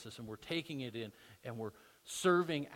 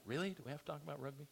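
mock bored ladies; 7 dB quieter, much faster than usual. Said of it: "all the ladies are saying".

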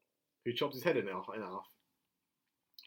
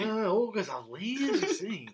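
slow, slightly raised ogre impression; Shrek; 5 semitones higher, slower than usual. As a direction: play the cartoon ogre, stretching the words. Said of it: "Shrek was there as well".

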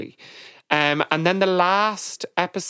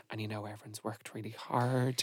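lower, very soft bad-news voice; mock solemn; 5 semitones lower, 14 dB quieter than usual. Judge pretending to reject a contestant, mock solemn. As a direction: hush it as if letting someone down gently. Imitating judges' sombre fake-out speech.

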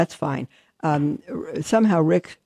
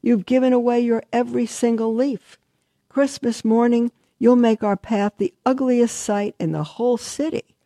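shrieking fir; 7 semitones higher than usual. A narrator's reading. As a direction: scold the old tree for giving away its leaves. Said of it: "shrieked the fir".

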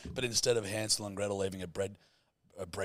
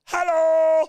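shrill sharp Dutch hello, much higher and louder; Dutch accent; 14 dB louder than usual. Pretend. Friend shows his Dutch impression, a Dutch-accented shouted hello. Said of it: "my impression of a Dutch person".

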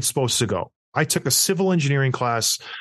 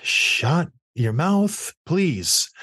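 slow retort; 3 semitones higher, much slower than usual. Retold or imitated. Retelling snapping back at wife.

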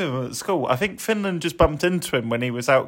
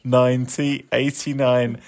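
ordinary talk, nothing marked

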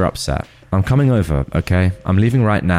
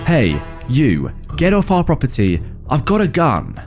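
threatening call; 7 semitones higher than usual. Acted. Yelling threat at intruder.

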